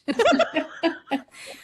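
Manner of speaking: bursting into a high laugh; delighted laugh at her own joke. Real, not acted. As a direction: laugh out loud, high and free.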